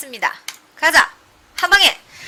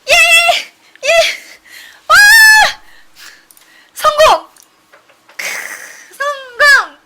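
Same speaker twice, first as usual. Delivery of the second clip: loud joyful shout of success; excited cheering; 12 semitones higher, 12 dB louder than usual. Real, not acted. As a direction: yell it out in triumph. (신나게 외치는 환호)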